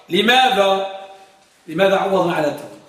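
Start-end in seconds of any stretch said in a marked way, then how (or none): none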